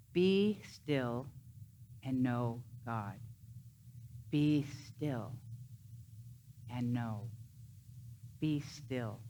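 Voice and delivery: soft meditative. Shown as a demonstration. Preacher guiding listeners inward, soft and fading. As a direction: let it settle quietly into stillness. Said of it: "You can say that to yourself".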